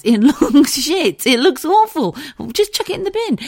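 high-pitched, exasperated pleading with mum; exasperated protest. Retold or imitated. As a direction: protest in high, incredulous disgust. Retold protest to her mum.

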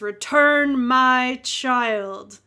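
slow, booming command; bellowing god. A narrator's reading. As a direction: drag it out like a booming deity. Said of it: "in a bellowing voice".

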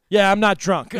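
put-on loud slurring drunk insisting; loud drunk denial. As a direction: bluster that you're sober. Drunk cop on phone.

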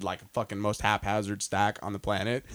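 ordinary talk, nothing marked

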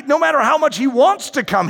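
normal talking voice

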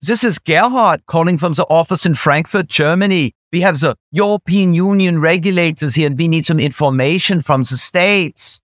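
put-on German-accented English, measured and businesslike. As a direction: play a German executive phoning a colleague. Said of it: "putting on a German accent".

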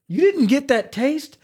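high, disbelieving squeak of a question; can't believe they missed that taste; incredulous question. Retold or imitated. Retelling disbelief after spitting meat.

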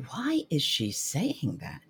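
slow, hushed, baffled murmur between clients; puzzled clients' aside. Retold or imitated. Prospective clients puzzled at the advisor's warning, slow hushed murmur. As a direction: voice the clients wondering under their breath.

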